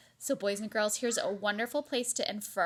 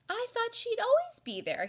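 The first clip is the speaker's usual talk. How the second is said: put-on high child voice; 14 semitones higher, 5 dB louder than usual. Child character voiced in story.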